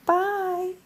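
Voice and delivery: put-on high, drawn-out little-kid voice; toddler's bye. Mom imitating her daughter leaving, high toddler voice. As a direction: imitate a one-year-old saying bye, high and long. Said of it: "and she goes".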